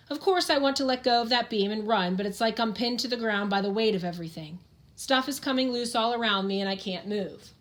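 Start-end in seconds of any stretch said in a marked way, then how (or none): none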